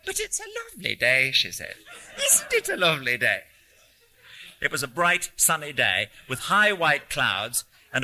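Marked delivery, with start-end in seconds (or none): 0.1-1.3 put-on high, cheery North Country old lady; delighted exclamation in a North Country accent. A narrator's reading. Old lady admiring the day to a stranger, North Country accent. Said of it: "in an English North Country accent". 2.2-3.4 put-on high, cheery North Country old lady; delighted exclamation in a North Country accent. A narrator's reading. Old lady admiring the day to a stranger, North Country accent. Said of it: "in an English North Country accent".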